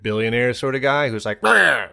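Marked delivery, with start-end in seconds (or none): none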